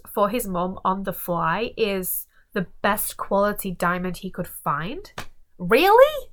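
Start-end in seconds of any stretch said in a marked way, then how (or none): 5.6-6.3 incredulous. Real, not acted. Mocking the ex's excuse.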